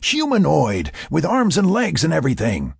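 high, emphatic exclamation; exasperated exclaiming. A narrator's reading. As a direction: insist excitedly, pitch jumping up. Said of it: "he exclaimed".

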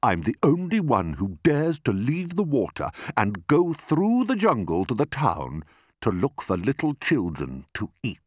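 brag, then relish the ending; self-important crocodile; boastful enormous crocodile. Acted.

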